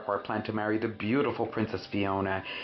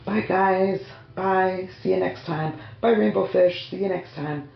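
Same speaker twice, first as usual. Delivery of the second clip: high, loud cheery puppet voices; friends saying goodbye; 9 semitones higher, 6 dB louder than usual. Acted. Special friends saying bye to viewers, high cheery voices. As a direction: pitch up and wave goodbye as the toy friends. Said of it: "let's say bye to our special friends".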